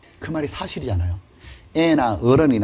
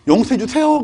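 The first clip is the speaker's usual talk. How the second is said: pleading; 9 semitones higher, 3 dB louder than usual. Pretend. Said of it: "voicing believers' plea".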